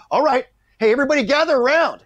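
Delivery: high, loud rallying call. Retold or imitated. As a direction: summon the whole crew. Director calls the crew together.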